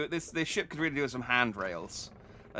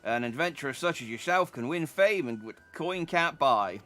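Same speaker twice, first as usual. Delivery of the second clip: higher, louder folksy voice on "Glory, eh"; a knowing old sailor approving; put-on accent; 4 semitones higher, 3 dB louder than usual. Sailor approves the glory hound, lightly put-on folksy accent. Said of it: "This accent is going all over the place".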